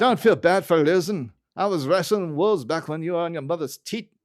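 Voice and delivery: lightly voiced gruff groundskeeper; a hint of Willie's brogue; playful mock-gruff boast. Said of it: "And Willie goes".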